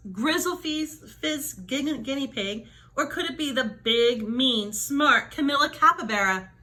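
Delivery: ordinary delivery, nothing marked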